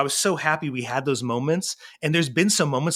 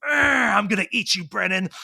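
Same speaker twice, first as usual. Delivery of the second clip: raised monster growl in play; playful growling; 5 semitones higher than usual. Retold or imitated. Dad growls back at little son, playful monster threat. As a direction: growl like a pretend monster about to pounce. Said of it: "growl back at him".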